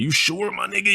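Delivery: high, doubtful homie impression; friend's voice. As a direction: ask again in his high voice. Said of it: "Yeah, that's how he talked".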